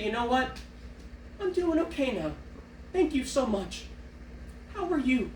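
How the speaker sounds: lightly voiced, charmed reply; touched and chirpy. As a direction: gush back at the kind voice. Answering the drive-thru voice.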